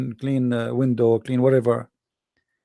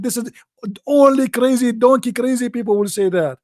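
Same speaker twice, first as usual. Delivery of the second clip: scornful caller; 5 semitones higher than usual. Retold or imitated. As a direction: berate him as deaf and stupid. Caller insulting the speaker.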